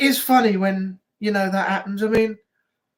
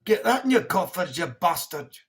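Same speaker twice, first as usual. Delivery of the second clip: mock Scottish insult; 4 semitones lower than usual.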